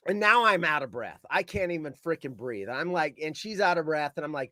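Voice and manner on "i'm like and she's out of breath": plain reading voice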